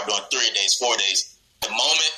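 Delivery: ordinary delivery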